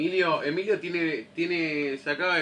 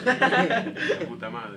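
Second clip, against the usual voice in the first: laughing; 10 semitones higher, much slower than usual. Real, not acted. Laughing exclamation.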